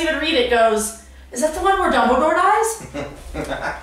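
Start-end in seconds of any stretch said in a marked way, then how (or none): 1.3-3.8 slightly higher, slow mimicking voice; mock casual question, pitched up; clueless spoiler. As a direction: imitate someone innocently asking, drawn out. Quoting the person who spoiled it.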